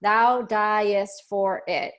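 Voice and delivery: "thou, thou diest for it" drawn out very slowly; counting the short line; slow and emphatic. Shown as a demonstration. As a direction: stretch each of the five syllables. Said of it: "is only five syllables".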